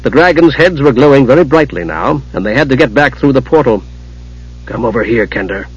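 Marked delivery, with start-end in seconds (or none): 4.6-5.7 low, softly commanding mage; quiet order. A narrator's reading. Raistlin summoning the kender to help, commanding. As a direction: give a quiet, lowered order. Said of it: "Raistlin ordered".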